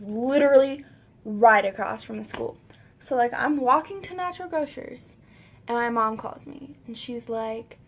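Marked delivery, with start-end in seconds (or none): none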